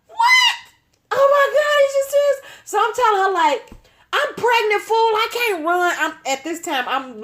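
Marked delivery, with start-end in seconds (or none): 0.1-2.4 very high, louder shocked cry; can't believe what she hears; shocked disbelief. Retold or imitated. Learning the car is stolen, very high disbelieving cry. 4.1-6.0 high pleading protest. Retold or imitated. Refusing to run while pregnant, high pleading.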